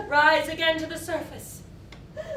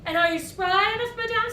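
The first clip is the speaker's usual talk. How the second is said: mocking taunt; 8 semitones higher, 6 dB louder, faster than usual.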